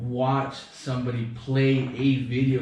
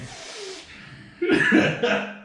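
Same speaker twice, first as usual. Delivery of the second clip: imitated wheezing snort. Imitating oxygen tank noise in background.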